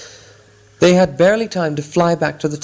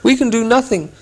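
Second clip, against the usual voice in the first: despairing; 9 semitones higher than usual. Acted. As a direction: give up aloud. Dwarves despair.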